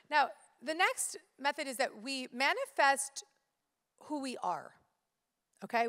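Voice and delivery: ordinary talk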